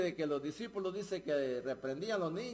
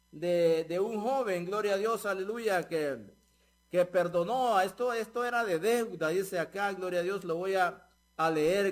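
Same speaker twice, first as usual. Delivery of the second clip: loud, driving sermon delivery with rhythmic "dice"; louder preacher's cadence punctuated by gloria a Dios; fiery preaching; 5 dB louder than usual. Acted. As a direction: preach it out to the congregation with full force. Preaching the parable at full force.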